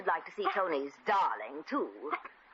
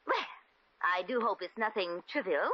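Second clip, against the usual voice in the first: ordinary delivery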